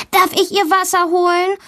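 put-on high excited little girl asking; a girl begging her teacher to fetch water, high and eager; eager child call. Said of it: "ruft Valentina".

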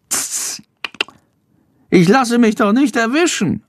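scoffing tss, then high bat bragging; boasting. A narrator's reading. Bat brushes off the boy's worry, boasting. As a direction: brag in a high squeaky voice. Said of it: "prahlt Schandor".

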